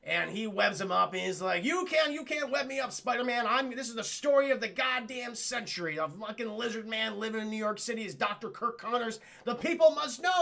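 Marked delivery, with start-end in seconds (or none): 1.6-10.4 high-pitched, fervent reporter outburst; hammed-up outrage, pitched up; acted indignant protest. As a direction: protest grandly, as if exposing a huge scandal. Brock protests being webbed up.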